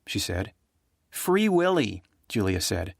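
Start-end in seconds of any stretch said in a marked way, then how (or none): 1.1-2.0 put-on high, earnest little-girl voice; a child gravely explaining a sad film; young girl. Young Julia telling the grown-ups about the film, in a high child voice.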